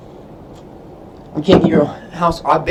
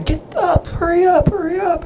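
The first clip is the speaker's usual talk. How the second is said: much higher, mock yelling hurry up; drill chief screaming; slower than usual. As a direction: shriek at the recruits stepping off the bus. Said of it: "they'll be screaming".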